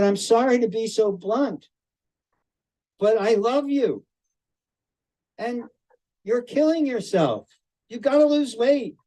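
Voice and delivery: firm love, telling him the hard truth; tough love. Retold or imitated. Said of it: "It's called tough love".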